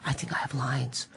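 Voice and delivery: slow, tiny whispered voice; timid whisper. Retold or imitated. Extra meekly telling the lady he has lines, whispering. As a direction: whisper it as a sheepish confession.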